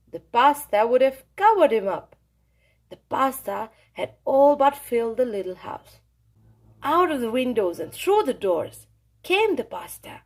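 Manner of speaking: ordinary delivery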